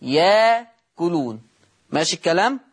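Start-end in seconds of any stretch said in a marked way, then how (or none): 0.0-1.4 very slow ياكلون, long aa running into the k; hamza turned to alif. Shown as a demonstration. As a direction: draw out ياكلون with no glottal catch after ya. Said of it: "تصير إيه؟".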